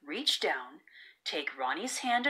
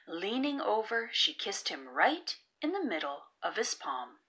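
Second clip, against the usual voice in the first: usual speaking voice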